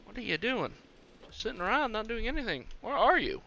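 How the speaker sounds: put-on a light baby-talk voice for a dinosaur; talking to a pet.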